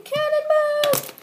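high, slow drawn-out yell; cannonball yell. Pretend. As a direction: stretch it out as you leap. Toy jumps in.

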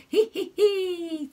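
high, slow witch cackle. Acted. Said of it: "said the witch".

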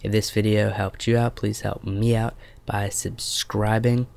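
ordinary talk, nothing marked

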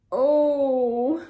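long, loud groaned "oh". A narrator's reading. Narrator voices overfed Hetty after the sausages, groaning. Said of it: "groaned Hetty".

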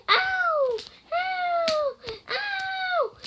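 long, very high "ow" wails; demonstrated pain cries. Shown as a demonstration. Showing viewers the water floor, high drawn-out ow. Shows what happens on the floor.

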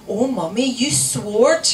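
put-on loud child voice rising in reproach; scandalised child. A narrator's reading. Small child catching mother swearing, scandalised. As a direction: tell Mummy off in shocked delight.

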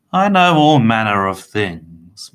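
loud, drawn-out gloating; gleefully boastful villain; cackled. A narrator's reading. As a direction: cackle it slowly with relish. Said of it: "Mister Tiny cackled".

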